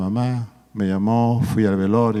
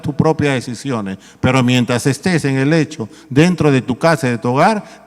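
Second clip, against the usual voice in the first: raised, insistent pulpit exhortation; preacher scolding children to obey their parents, raised and firm; forceful preaching; 4 semitones higher, 3 dB louder than usual. Acted. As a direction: drive the commandment home to the youth.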